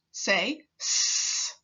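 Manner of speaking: a voiceless hiss on s. Shown as a demonstration. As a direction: let the s in "Say s." hiss out with no voice. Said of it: "begin with the s sound".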